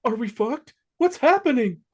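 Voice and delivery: high frantic questions; panicked. Acted. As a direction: scramble in panic. Freddy panicking in script.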